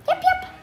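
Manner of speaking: squeaky little yip yip; high puppy yips. Pretend. Child reading a dog book, squeaky puppy yips.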